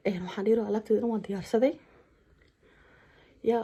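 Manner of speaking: ordinary delivery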